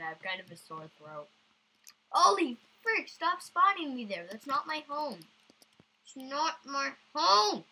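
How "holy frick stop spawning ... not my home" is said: exasperated. Real, not acted. Frustrated at respawn point.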